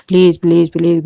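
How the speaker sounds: loud, desperate begging; loud plea. Real, not acted. Mother begging her guru to calm her anger, loud. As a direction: cry out please to the guru.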